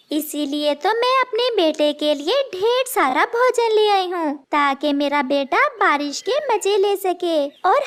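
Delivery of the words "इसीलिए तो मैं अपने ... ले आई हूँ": ordinary delivery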